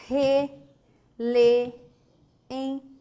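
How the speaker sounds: "Re, lê, em" in separate stretched syllables. Shown as a demonstration. Teacher gives another hiatus, "Re, lê, em" split. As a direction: pause after each piece of "Re, lê, em". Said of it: "formado por vogais idênticas?".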